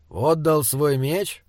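usual speaking voice

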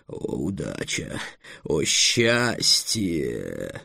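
low, drawn-out, reverent exclamation; dragon's rapture. Acted. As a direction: stretch each word slowly in deep bliss. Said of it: "произнёс дракон". (низко, протяжно, с упоением)

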